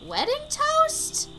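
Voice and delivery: slow, climbing high on "toast"; baffled question. Acted. Camper misreading a toast at the fire, baffled. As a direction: stretch it out and swoop right up.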